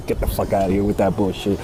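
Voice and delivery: laughing scoff. Real, not acted. Dismissing sweet talk from men, laughing brush-off. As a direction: laugh it off with contempt.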